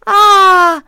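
loud high startled "Ah!"; startled cry. Acted. Tree waking to grab the magpie, loud high startled cry. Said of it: "esclamò l'albero".

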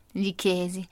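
ordinary delivery, nothing marked

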